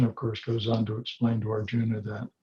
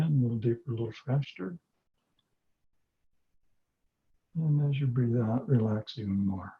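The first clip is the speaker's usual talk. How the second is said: guided meditation; 3 dB quieter than usual. Acted. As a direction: ease them into calm with long pauses. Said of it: "we're going to go through a little guided meditation process".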